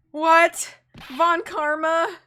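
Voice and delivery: high, loud, stretched-out shock; loud disbelief. Real, not acted. Player learns Von Karma is back, loud disbelief. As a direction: blurt it out, incredulous, dragging the name. Reacts to the villain's return.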